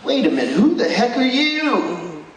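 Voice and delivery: imitating Buddy. Preacher voicing Buddy confronting the fake Santa, mock outrage. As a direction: draw out his disbelief at the fake. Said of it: "Buddy goes".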